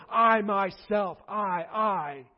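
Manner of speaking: each I drawn out with weight; slow, loud, insistent I; emphatic I. Shown as a demonstration. Preacher hammering home who blesses, slow emphatic stress on I. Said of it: "the emphatic "I".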